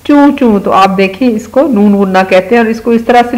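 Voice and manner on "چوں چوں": higher, chirpy little cheep for a sparrow; sparrow chirp. Retold or imitated. Teacher imitating a sparrow for the kids, higher chirp. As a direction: squeak it like a tiny bird. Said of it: "چڑیا کیا بولتی ہے".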